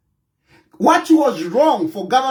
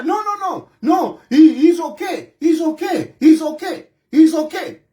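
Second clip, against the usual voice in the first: mocking denial; 5 semitones higher than usual.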